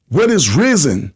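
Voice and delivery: ordinary talk, nothing marked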